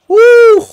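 very high, loud "woo"; celebratory whoop. Real, not acted. Gamer celebrating the winning jump, celebratory whoop. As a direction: let out a piercing victory whoop.

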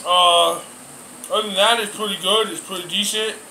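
pausing mid-meal to speak; casual table talk while eating; talking between bites. Real, not acted. Seen 3: Holds fork over bowl, talks; no bite taken during words.

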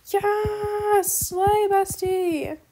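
high cheerful yes slay bestie; cheering. Real, not acted. Cheering friend on.

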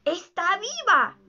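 high, drawn-out exclamation; excited assurance. A narrator's reading. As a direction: announce it slowly, voice lifted with excitement. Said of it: "aseguró enseguida Peter".

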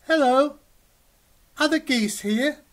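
put-on high, loud baby-goose voice, asking eagerly; high little gosling. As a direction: call out like a tiny lost gosling. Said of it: "And Goz says".

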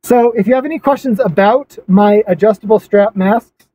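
muffled by cloth mask; speaking behind fabric covering; through a face mask. Real, not acted. Seen 5: Fabric mask covers his mouth and nose throughout the labelled words.

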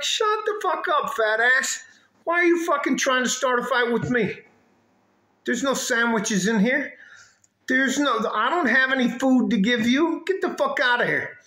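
high-pitched, animated telling-off; retold angry retort. Man telling the woman off at the bank, retold angry retort. His side of acted quarrel.

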